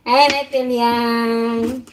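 slow, loud, sing-song hello; drawn-out greeting. Real, not acted. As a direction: stretch the greeting out warmly and loud.

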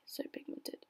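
whispered beauty guru gush; beauty guru impression. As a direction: breathe it like a makeup influencer. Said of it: "I sound like a Manny MUA".

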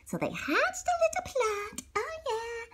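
soft, high whisper; conspiratorial whisper. A narrator's reading. Narrator whispering about the kids' plot. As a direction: whisper like sharing a secret.

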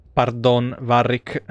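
ordinary talk, nothing marked

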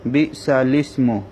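slow "বিসালিসমু" with lam joined to sin; clear articulation. Shown as a demonstration. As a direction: articulate each syllable of "বিসালিসমু". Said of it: "স্পষ্ট বোঝা যেতে হবে".

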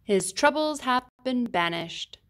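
plain reading voice

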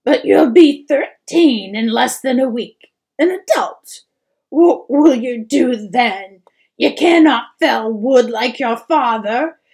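tearful pleading. A narrator's reading. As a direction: sob it out, voice breaking. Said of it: "she sobbed".

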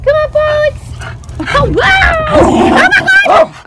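high, loud surprised cry; excited shout. Real, not acted. Owner reacting to muddy dog, excited shout. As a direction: yelp in delight at the muddy dog.

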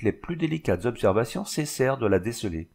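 plain reading voice

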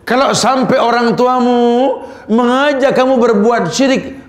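high, drawn-out sermon delivery with recited verses; impassioned preaching. Acted.

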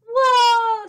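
very high, loud drawn-out whoa; long alarmed yelp; startled cry. A narrator's reading. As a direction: cry out as if yanked off your feet. Yanked through air by rope.

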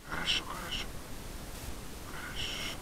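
whispered soothing praise; calm the dogs in a whisper. Real, not acted.

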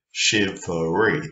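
"shivery" slowly, in three beats; slow, measured syllables on "shivery". Shown as a demonstration. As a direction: sound out "shivery" as shiv, er, ree. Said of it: "that's three syllables".

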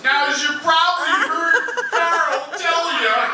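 high, loud, slow motivational bark; shouty Matt Foley pitch, high and slow; Matt Foley impression. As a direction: hammer it like an overexcited speaker. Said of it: "Matt Foley from Saturday Night Live. Something like this".

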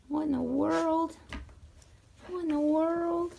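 high, slow puzzled animal voice. Pretend. The barn animals reacting to her arrival, high puzzled voice.

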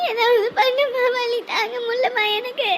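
high, thin tearful wail; crying out from labour pain; sobbing in pain. Acted. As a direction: beg through sobs, stretching the last word. Daughter in labour pain begs mother.

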